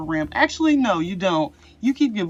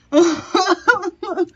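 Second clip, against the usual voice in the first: high loud burst of laughter; 10 semitones higher, 6 dB louder than usual. Real, not acted. Reader laughing at the mischievous Fae, loud high laugh.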